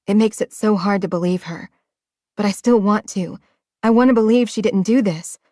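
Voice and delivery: ordinary delivery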